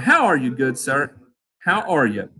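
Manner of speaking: ordinary talk, nothing marked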